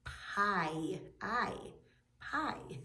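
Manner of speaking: "pie", then the long "I" alone, then "pie"; isolated vowel. Shown as a demonstration. Teacher showing where the I sound is, isolating "I" in "pie". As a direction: pick the "I" out of "pie" with pauses between. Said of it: "there the I sound is in".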